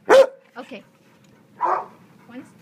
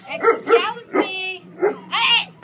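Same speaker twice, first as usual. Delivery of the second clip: sharp loud call to a dog; loud scolding; 6 semitones higher, 9 dB louder, much slower than usual. Real, not acted. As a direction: stop the dog with a loud word.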